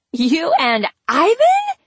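very high, loud, incredulous shriek; drawn-out squeal of disbelief. A narrator's reading. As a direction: shriek it slowly, shooting up at the end. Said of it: "she shrieked".

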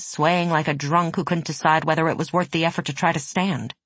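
plain reading voice

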